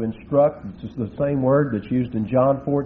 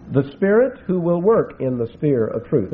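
ordinary delivery

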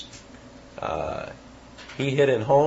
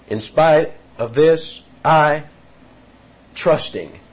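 slow, raised "In spite of this", "I" loud and high, long pause, "trusting" dropped; halting emphasis; 5 semitones higher, 6 dB louder, slower than usual. Shown as a demonstration. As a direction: punch "I" and halt before letting "trusting" fall. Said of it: "almost halting in its approach".

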